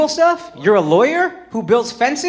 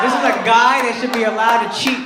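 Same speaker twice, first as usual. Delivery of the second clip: ordinary delivery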